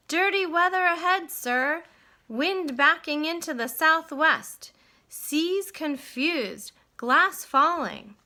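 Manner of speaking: loud, high warning shouts across the water; calling out. Acted. Stuart calling a storm warning to the owner, loud shout. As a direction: call the storm report to the owner ashore. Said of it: "he waved his hat and called out".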